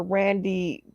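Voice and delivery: ordinary talk, nothing marked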